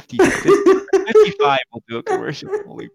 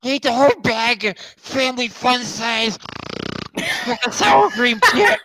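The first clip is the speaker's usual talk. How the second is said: gluttonous fat guy voice; 7 semitones higher than usual. Pretend. Gluttonous bit, far timbre.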